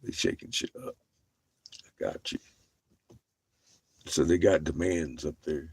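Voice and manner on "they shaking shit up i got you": breathy whisper; whispered aside. Real, not acted. Whispering an aside to chat.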